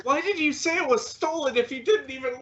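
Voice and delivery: hurt protest. Acted. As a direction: demand an explanation, bewildered. Rejected suitor protests.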